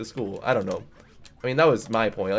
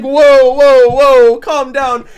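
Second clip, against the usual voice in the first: much higher, much louder alarmed yell of whoa; freaked-out yelling at the knife; panicked shout; 12 semitones higher, 15 dB louder, much slower than usual. Retold or imitated. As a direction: shout like a kid startled by a knife. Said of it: "he's like".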